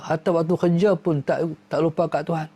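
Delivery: ordinary talk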